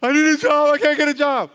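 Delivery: panicked praying about rent; anxious crying prayer. Acted. A broke believer begs God for rent money, crying. Desperate person complains.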